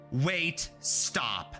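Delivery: ordinary delivery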